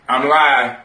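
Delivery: ordinary talk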